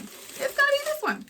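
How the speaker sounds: "It's gonna be this one" squealed very high at the top of the voice. Acted. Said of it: "repeated with rising emphasis".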